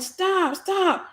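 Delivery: high, urgent plea; pleading stop. Retold or imitated. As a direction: beg him to stop, up high. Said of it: "I'm like".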